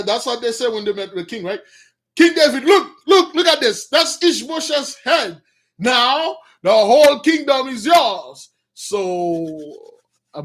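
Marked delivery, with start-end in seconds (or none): 2.1-8.5 raised, loud, proud announcement; eager presenting. Retold or imitated. The brothers showing King David the head, loud eager boast. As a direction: show off the trophy like a prize.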